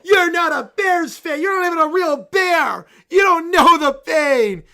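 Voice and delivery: shouting tantrum. Acted. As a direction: scream it at her like a kid refusing. Bear tantrum at mom.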